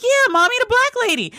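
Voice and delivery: gleefully confirming it for her mom; retelling how she gushed back to her mother; excited insisting. Retold or imitated. Daughter insisting to mom, retold.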